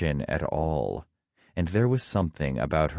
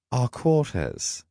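slow, high-pitched repeat; taken-aback sidekick; disbelieving echo; 8 semitones higher than usual. A narrator's reading. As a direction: echo it back, drawn out and incredulous. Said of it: "Loki echoed".

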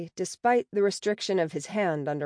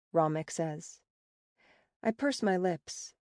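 plain reading voice

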